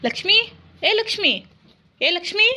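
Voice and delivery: loud, high-pitched hail; calling out. Acted. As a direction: call to someone down the street. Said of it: "ಯಾರೋ ಕೂಗ್ತಿದಾರ್ರಿ". (ಜೋರಾಗಿ ಹೆಸರು ಕೂಗುವುದು)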